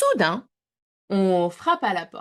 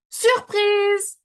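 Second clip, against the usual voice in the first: much higher, louder burst; excited shout; 13 semitones higher, 7 dB louder than usual. A narrator's reading. Cochonnet jumping out at the wolf, shouting with glee. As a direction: leap out and cry it with joy. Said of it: "bondit hors de sa cachette et s'écrie".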